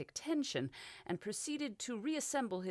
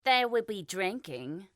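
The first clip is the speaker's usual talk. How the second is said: lazy; 3 semitones higher, 6 dB louder, slower than usual. A narrator's reading. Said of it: "Francesa pointed out lazily".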